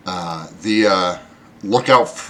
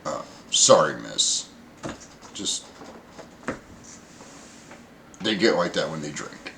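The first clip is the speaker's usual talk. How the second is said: apologetic bosun; 6 semitones lower, 8 dB quieter than usual. Acted. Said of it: "he looks at you and goes".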